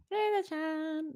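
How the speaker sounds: high, slow, cutesy sing-song; playful cutesy. Shown as a demonstration. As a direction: drawl it out sweetly as a tease. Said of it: "play thing to say".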